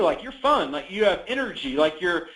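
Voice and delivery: ordinary delivery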